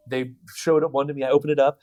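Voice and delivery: usual speaking voice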